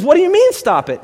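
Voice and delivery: high, loud baffled question; incredulous. Acted. Client questions therapist's blunt advice, incredulous. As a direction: question it in startled disbelief.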